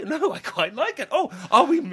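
acted innocent protest, rising in pitch; mock surprise. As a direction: play surprised at being moved. Acts feigning innocence to hotel staff.

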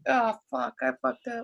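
quiet mock oh-no; regretful self. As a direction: realize it under your breath. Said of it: "I was like".

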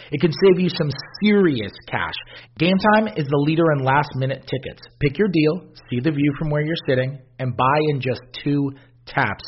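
loud, upbeat sponsor read. Acted. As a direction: sell it like a radio ad. Host reads sponsor ad.

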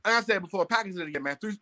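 ordinary delivery

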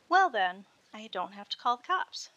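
loud teasing opening, sly mockery; mocking. A narrator's reading. Sorority girl mocking the nervous visitor. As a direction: tease him like he's a suspect. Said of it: "she mocked".

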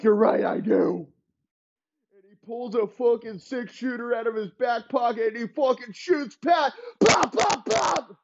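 high, shouted gunfight frenzy; manic shout. Acted. Elway shouts wildly while shooting Pat. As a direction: yell it like you're firing.

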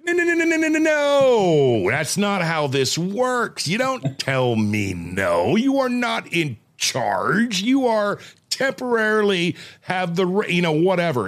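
high, slow, stretched-out scolding; hammed-up indignant dad telling off; acted parental outrage. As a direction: lay down the law, dragging out each word. Retold scolding of defiant daughter.

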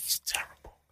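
whispered under the breath; muttered whispered verdict. Real, not acted. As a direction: hiss it low, just air. Repeating complaint about [name].

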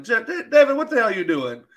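high, loud cry of disbelief at Devin; exasperated disbelief. Retold or imitated. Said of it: "and I was like".

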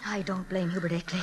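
whispered, a bit fast, to herself; barely voiced aside; teacher muttering her bitterness after the coach leaves; hushed whisper.

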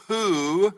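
"Who" stretched out, high and loud; stress on Who. Shown as a demonstration. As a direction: hold "Who" long before moving on. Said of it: "I'll emphasize the words".

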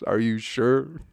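slow, drawn-out doubtful question; mock prick. Drunk stranger doubting the host's home, slowly. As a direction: sneer it slowly like a smug drunk. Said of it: "he had to kind of be a prick".

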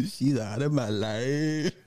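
slow, hammed-up sorrow; mock heartbroken. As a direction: fake grief for the live stream, drawn out. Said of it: "be like".

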